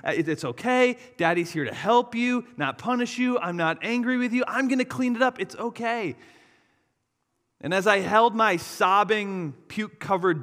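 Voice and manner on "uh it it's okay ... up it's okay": reassuring dad. Retold or imitated. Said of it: "I kept reassuring her".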